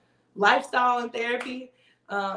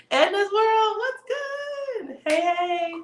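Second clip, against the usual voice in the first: high, bright hello to a viewer; excited greeting; 11 semitones higher, much slower than usual. Real, not acted. Greeting a viewer channel on the live stream, much higher and excited. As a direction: call out a happy welcome.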